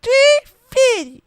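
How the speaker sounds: high falsetto meme punchline; squeaky meme voice. Pretend. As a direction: squeak it out like a cartoon.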